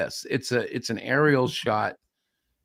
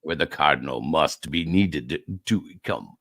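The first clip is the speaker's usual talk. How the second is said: very low, halting mock Italian; terrible Italian accent; 10 semitones lower than usual. Pitching the Cardinal story, low and badly faked Italian accent. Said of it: "terrible attempt at an Italian accent".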